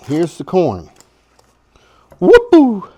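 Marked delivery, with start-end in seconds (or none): 2.2-2.9 high, loud excited whoop. Real, not acted. Cook celebrating the corn with a loud excited whoop. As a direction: whoop it up with joy.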